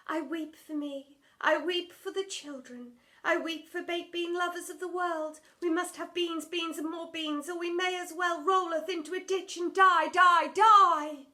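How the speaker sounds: high, loud, overwrought stage voice; hammed-up old-English drama queen; theatrical Shakespearean lament. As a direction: wail it like a tragic heroine on stage. Drama teacher mourns baked beans.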